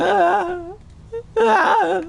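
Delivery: piercing high wailing "ah, ah"; imitated scream. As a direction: imitate the old man's screaming from the front door. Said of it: "laying on the ground going".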